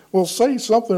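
high-pitched acted retort; mock exasperation. Speaker retorts in retold exchange.

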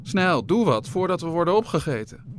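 high, loud, urgent plea; panicked cry. A narrator's reading. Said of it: "raakte hij in paniek".

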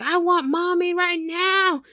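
high, stretched mock tantrum wail; whining child. Small child demanding mommy in a fit, high mock wail. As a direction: imitate a little kid throwing a fit. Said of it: "that was having a fit".

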